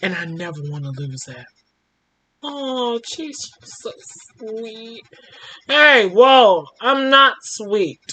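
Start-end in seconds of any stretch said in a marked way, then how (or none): 2.4-5.0 whispered aside. Real, not acted. Listener murmurs aside.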